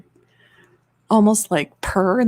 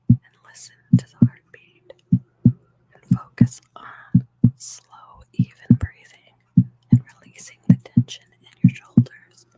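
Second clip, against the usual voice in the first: guided relaxation whisper; 7 semitones lower, 6 dB louder than usual. Real, not acted. Streamer easing viewers into relaxation, whispered.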